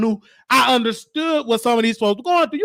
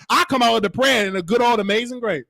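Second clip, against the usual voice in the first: usual speaking voice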